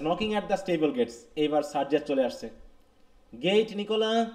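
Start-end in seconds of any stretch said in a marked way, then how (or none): 3.4-4.3 mild higher-pitched call to a servant; lightly acted summons. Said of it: "নিকোলাকে ডাকতেছে".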